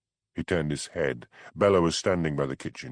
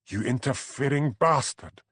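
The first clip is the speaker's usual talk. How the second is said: hissed insult; 6 semitones higher than usual. A narrator's reading. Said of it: "hissed Bella".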